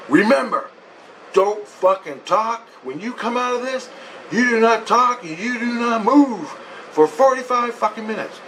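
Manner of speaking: high, insistent retold order; stern warning. Woman warns patient before the machine starts, stern warning.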